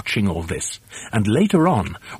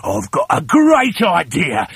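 drawn-out, higher villain's cry; excited cry; 4 semitones higher, 4 dB louder than usual. Acted. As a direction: announce a scheme with noisy excitement. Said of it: "he cried".

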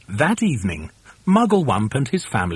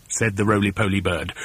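usual speaking voice

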